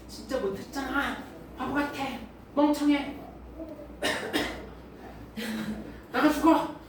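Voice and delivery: a lightly voiced harsh inner critic, sneering at herself; mildly mock the nagging voice in your own head. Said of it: "내 안에서도 그런 목소리가 들린다는". (자기 자신을 비난하는 마음속 목소리를 살짝 흉내 내듯)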